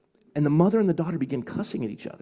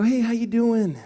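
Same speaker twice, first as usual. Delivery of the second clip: bright put-on hello, much higher and louder; fake cheerful greeting; 10 semitones higher, 5 dB louder, slower than usual. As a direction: greet her with a forced pastor smile. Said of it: "I put on the facade".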